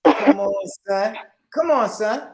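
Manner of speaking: New York tough guy; New York accent. Retold or imitated. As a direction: Brooklyn street voice. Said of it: "Do a New York accent".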